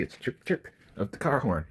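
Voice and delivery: normal talking voice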